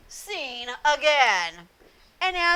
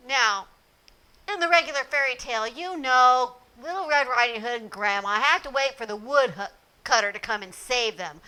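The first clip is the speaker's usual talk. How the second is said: ordinary delivery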